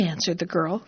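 usual speaking voice

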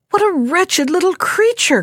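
a high, scornful cry at the tiny catch. A narrator's reading. Poor fisherman scorns his tiny catch, high scornful cry. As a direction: exclaim in disgust, pitched well up. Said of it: "cried one brother".

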